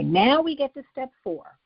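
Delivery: usual speaking voice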